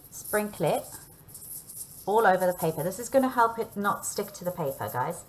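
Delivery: normal talking voice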